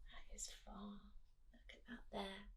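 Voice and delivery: soft unvoiced whisper. Real, not acted. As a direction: whisper it like a secret. Aside to children.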